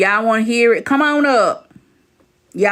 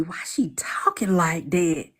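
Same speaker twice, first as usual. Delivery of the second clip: mock whisper of a puzzled partner; imitating a whisper to ask why she talks like that; whispered quote; 5 semitones lower, 6 dB quieter than usual. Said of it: "Baby Daddy have even asked me".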